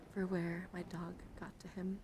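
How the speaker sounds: ordinary delivery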